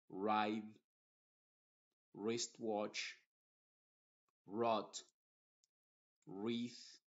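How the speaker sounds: wreck, wrong, wrap each start straight on the R; WR words with silent W. Shown as a demonstration. Teacher lists WR words, W silent, from the R. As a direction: drop the W and begin on the R. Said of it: "desde la R hacia adelante".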